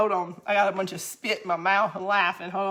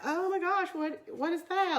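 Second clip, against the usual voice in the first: higher, softer mock-astonished onlooker; 8 semitones higher, 6 dB quieter than usual. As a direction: imitate someone aghast at an unfamiliar word. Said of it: "they were like".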